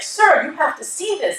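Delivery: high-pitched mock indignation; retold urgent appeal. Girlfriend retells showing him the sign, high urgent appeal.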